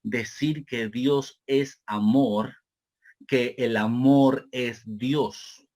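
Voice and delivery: slowly and deliberately, for note-takers; slow, spaced-out phrasing with drawn-out words; slow dictation pace. Real, not acted. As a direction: spell out the statement at dictation speed. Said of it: "lo voy a decir despacio".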